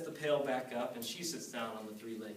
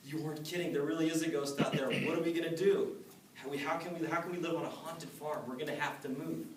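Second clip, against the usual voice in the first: higher, louder, fast frightened rush; scared wife blurting it all out; panicked; 5 semitones higher, 4 dB louder than usual. Acted. Frightened wife telling Matt about the ghost, panicked and higher.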